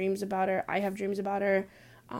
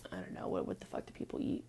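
whispered aside; 13 semitones lower, 11 dB quieter, faster than usual. Real, not acted.

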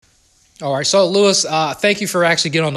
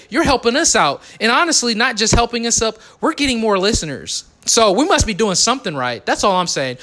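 higher, louder, fired-up rant at the listeners; heated rant; 7 semitones higher, 3 dB louder than usual. Real, not acted. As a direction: push it like a pep-talk rant, pitched up. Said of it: "So that's my rant".

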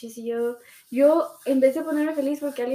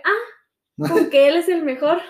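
loud, raised mock indignation; jealous accusation; 3 semitones higher, 8 dB louder, slower than usual. Jealous boyfriend writing back about her [name] post, accusing. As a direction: throw the words back accusingly, hammed-up.

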